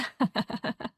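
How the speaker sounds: airy teasing laugh; soft mocking chuckle; breathy laugh. Teasing about nicknames.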